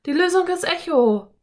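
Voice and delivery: high, loud, triumphant cry; excited children calling. A narrator's reading. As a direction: shout the answer as two excited kids. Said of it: "riefen Anne und Philipp".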